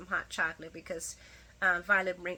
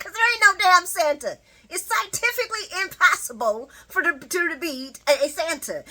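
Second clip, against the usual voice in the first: Leo explaining; 8 semitones higher, 9 dB louder than usual. Retold or imitated. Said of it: "he's like".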